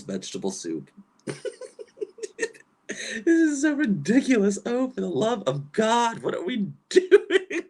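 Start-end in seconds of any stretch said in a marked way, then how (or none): 1.3-2.5 sudden high squeal of laughter; high squeaky laugh. Real, not acted. Laughing at video. 3.2-7.6 laughing exasperation. Real, not acted.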